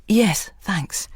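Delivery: muffled through a scarf. Acted. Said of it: "Her voice was muffled in her striped wool scarf".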